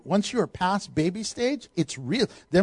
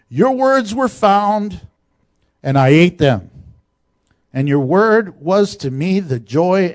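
scripture reading; 3 semitones lower, 5 dB louder than usual. Acted. Reads scripture with force.

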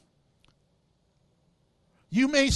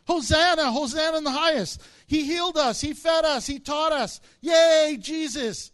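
loud, higher, jubilant crowd cheer; 5 semitones higher, 6 dB louder than usual. Pretend. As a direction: hail him like an adoring mob.

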